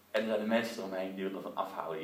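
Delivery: ordinary delivery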